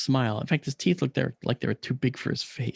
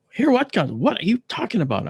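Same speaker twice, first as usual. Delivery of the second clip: high, loud indignant retort; getting mad; 8 semitones higher, 4 dB louder than usual. Retold or imitated. Patient retelling an argument with his captors, getting mad. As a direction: throw it back, incredulous and annoyed. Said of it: "I was really getting mad".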